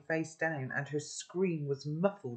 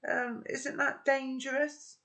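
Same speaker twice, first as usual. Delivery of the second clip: trying to sound casual; 10 semitones higher than usual. A narrator's reading. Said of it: "Sophie tried to look casual".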